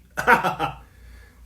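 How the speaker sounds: a loud, high mocking laugh; imitating a mocking laugh. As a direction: laugh at him the way they do. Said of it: "and they laugh at me".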